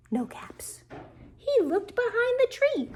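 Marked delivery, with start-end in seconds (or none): 0.1-0.8 whispered storytelling suspense; hushed whisper. A narrator's reading. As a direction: drop to a whisper, as if disappointed. Hushed reveal while reading a story.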